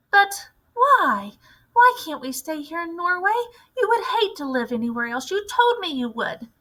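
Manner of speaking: put-on high, young voice protesting to grandmother; a boy begging not to move; young grandson pleading with his grandmother. A narrator's reading.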